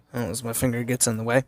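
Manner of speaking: ordinary delivery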